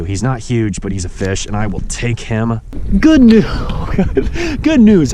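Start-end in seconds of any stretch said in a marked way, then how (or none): 3.0-5.1 high, loud triumphant call; joyful shout. Real, not acted. Angler loudly celebrates his catch, joyful. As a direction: shout the good news.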